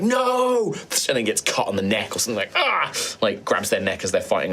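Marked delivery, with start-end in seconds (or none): 0.0-0.7 anguished cry. Acted. GM voices sergeant crying out. 2.6-2.9 pained yelp. Acted. GM voices sergeant hit in neck.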